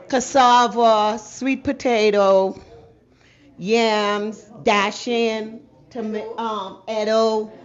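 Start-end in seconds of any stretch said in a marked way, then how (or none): none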